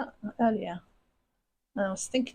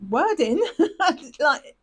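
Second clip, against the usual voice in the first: laughing; 6 semitones higher, 6 dB louder, slower than usual. Real, not acted. Laughs mid-explanation.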